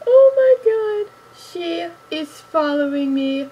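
loud, slow, high shriek of joy; excited shriek. Real, not acted. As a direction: scream it out in disbelief. Reacting to being followed back.

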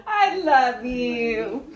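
slow, tender through tears; tearful love. Real, not acted. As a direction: say it to a dear friend. Thanking friend through tears.

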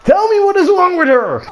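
high, pleading frustration; exasperated outburst. Real, not acted. Gamer begging the game to explain the opponent, exasperated. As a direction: demand an answer from the game.

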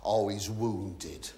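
low, soft and very slow, drawn-out sympathy; "Oh, he's wounded." sighed like the audience pitying him; mock pity. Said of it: "You're saying to yourselves".

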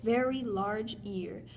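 ordinary delivery

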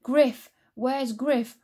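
loud worried call. A narrator's reading. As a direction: call out for the cat. Moll calls for missing cat.

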